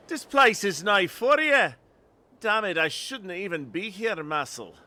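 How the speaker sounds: loud, high, gruffly indignant Scottish voice; Scottish accent. Acted. Scottish lookout warning visitors away, indignant Scottish accent. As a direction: warn them off in Scottish, easing down at the end. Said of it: "get the Scottish accent going".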